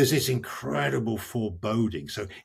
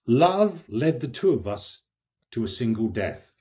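poetry reading; 3 semitones lower than usual. Acted. Reading what Francesca says of her killer, poetry reading. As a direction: start raised, then sink into understatement with long pauses. Reads Francesca's lines aloud.

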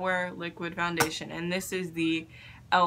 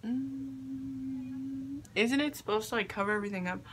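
ordinary talk

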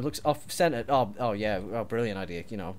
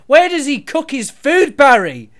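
louder, higher, slow indignant yell; exasperated shout; 10 semitones higher, 12 dB louder than usual. Acted. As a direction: demand an answer in outrage. Heated argument over the kitchen.

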